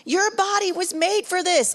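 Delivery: a hushed, high tender whisper. Retold or imitated. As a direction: mentor whispering comfort to pregnant girl. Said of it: "She said".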